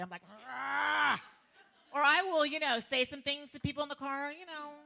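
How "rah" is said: loud mock roar of frustration; raised, exasperated growl; enraged roar. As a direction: roar like you've lost it. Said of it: "I lose my peace".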